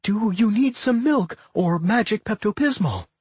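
high-pitched, anxious offer; worried. A narrator's reading. Claire offers remedies to the shaken Millie, worried. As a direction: fuss over a patient, pitched high.

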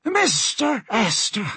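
slow, high-pitched surprise; surprised captain. A narrator's reading. Captain finds passenger at his door, surprised. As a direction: draw out the name in a high voice. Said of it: "His anger instantly turned to surprise".

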